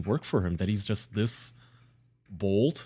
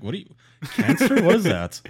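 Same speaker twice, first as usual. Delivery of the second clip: mock clueless voice; 5 semitones higher, 4 dB louder than usual. Mocking the predator's ignorance.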